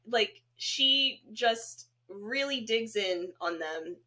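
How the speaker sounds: ordinary delivery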